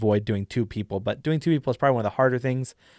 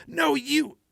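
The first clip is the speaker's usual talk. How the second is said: high-pitched comeback; arguing character. Acted. Taken-aback man answers her in a staged argument, pitched high. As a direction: throw the accusation back in a very high character voice. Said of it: "points a finger at her and says".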